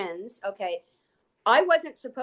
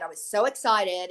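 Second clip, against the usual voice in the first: ordinary talk, nothing marked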